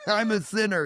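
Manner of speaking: laughs it off, high and bright; chuckling self-mockery while confessing; laughing. Real, not acted. Man laughing to his host about being a sinner. Laughing at himself, ain't no Jesus.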